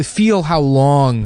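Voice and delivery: drawn-out words, each one stressed; slow emphatic lecture. Acted. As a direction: lecture a child, slowly. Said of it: "lecturing cohost".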